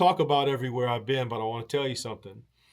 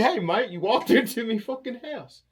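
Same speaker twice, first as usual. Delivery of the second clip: higher mock Aussie, half laughing; Aussie host; 8 semitones higher, 3 dB louder than usual. Aussie host teasing the guest who walked into his house, laughing mock Aussie. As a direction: laugh through a cheeky Aussie jab. Imagined Australian scolding.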